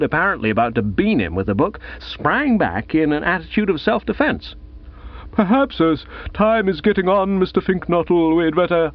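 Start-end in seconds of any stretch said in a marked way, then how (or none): 5.3-8.9 a hint of a polite headmaster; gently cutting in, a touch formal; lightly voiced headmaster. Bearded headmaster interrupts politely.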